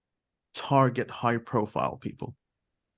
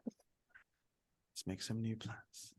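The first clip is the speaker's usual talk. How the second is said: soft slow; 4 semitones lower, 19 dB quieter, much slower than usual. Acted. Teacher voices Ross planning.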